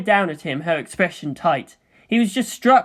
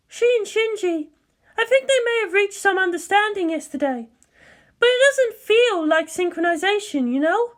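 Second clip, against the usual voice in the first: Misato's voice. Acted. Misato telling Ritsuko something's off, higher and uneasy. Misato explains her hunch.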